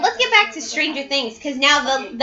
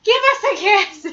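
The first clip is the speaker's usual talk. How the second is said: imitating Minnie Driver; 8 semitones higher than usual. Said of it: "Minnie Driver does in Good Will Hunting".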